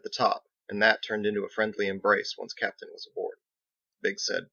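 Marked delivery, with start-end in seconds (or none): none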